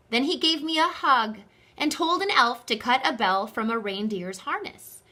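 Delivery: ordinary delivery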